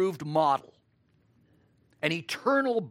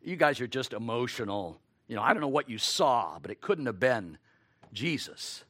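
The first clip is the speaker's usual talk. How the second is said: a hint of doubting friends scoffing; sceptical onlookers; 7 semitones lower, faster than usual. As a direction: brush them off as just emotional, mildly. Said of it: "they're probably saying to these two men".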